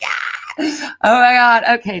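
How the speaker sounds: usual speaking voice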